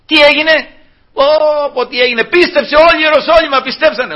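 high, exclaiming excitement; mock amazement. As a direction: marvel loudly, as if all were won. Imagined excitement over the miracle. (ενθουσιώδης, δήθεν κατάπληξη)

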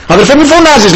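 high-pitched mimicked reproof; mock objection. Imitating a church member telling a brother not to shout, high mock reproof.

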